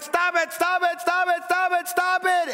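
high, sustained yell on repeat; retold yelling. Parent yelling at both fighting kids, high and insistent. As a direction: reenact an exasperated parent yelling, pitch way up. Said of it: "I start yelling at the both of them".

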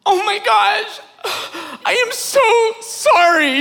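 high, flustered apology with a laugh; friend's wife apologizing to the pastor, high mortified squeal; embarrassed woman. Retold or imitated. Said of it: "she was like".

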